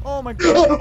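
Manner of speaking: sudden frightened shout; jump-scared yell, loud and high; startled scream. Real, not acted. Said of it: "You scared the shit out of me".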